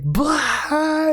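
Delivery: high, long crowd outburst noise; high-pitched hammed-up "blah"; mock burst of laughter. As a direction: imitate friends exploding with laughter. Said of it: "just start dying laughing".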